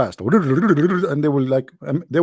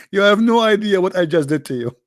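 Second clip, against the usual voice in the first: laughing; 5 semitones higher than usual. Real, not acted. Laughing through his curse.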